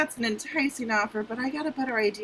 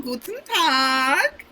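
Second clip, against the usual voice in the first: slow, sing-song German greeting; drawn-out German hello; German accent; 5 dB louder, much slower than usual. Acted. Fake Swiss Miss greeting.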